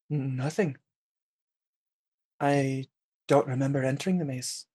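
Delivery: put-on soft, quiet French accent; a hushed French lilt. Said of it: "she's speaking in a French accent".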